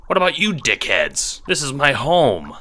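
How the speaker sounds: loud, high-pitched indignant outburst; annoyed. A narrator's reading. Renegade confronting intruders in the home, annoyed.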